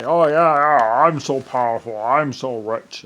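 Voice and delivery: loud, smug mimicry of the rich; mock boast. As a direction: puff up and gloat, slowing at the end. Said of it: "they're trying to say".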